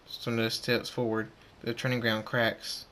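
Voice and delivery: ordinary delivery, nothing marked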